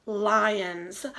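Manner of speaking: ordinary talk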